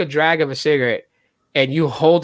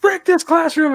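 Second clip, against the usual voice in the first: mock outburst; 11 semitones higher, 3 dB louder than usual. Retold outburst before wrecking room.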